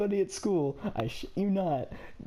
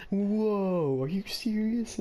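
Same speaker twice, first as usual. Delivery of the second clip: higher-pitched mock-awed kids; imitated amazement; 4 semitones higher, much slower than usual. Kids reacting to his tall tale, mock amazement. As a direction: play the wowed schoolkids, pitched up. Retelling impressed kids' reaction.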